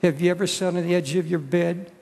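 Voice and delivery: ordinary talk, nothing marked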